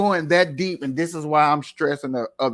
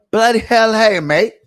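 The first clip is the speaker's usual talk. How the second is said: loud, high mock British accent; 8 semitones higher, 9 dB louder than usual. Shown as a demonstration. Host plays a Brit reacting to hallway, mock British accent. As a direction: put on a hammed-up British accent. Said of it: "let me put on my British voice".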